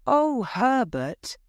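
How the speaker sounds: mother's high, drawn-out protest; worried cry. A narrator's reading. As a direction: cry out to your son in worry, slow and high. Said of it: "his mother cried".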